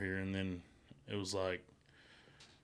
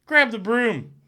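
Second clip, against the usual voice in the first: higher and louder, calling out in disbelief; exasperated shout; 10 semitones higher, 5 dB louder than usual. Retold or imitated. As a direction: yell it across the garage. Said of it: "I'm like".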